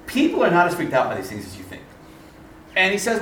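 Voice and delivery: usual speaking voice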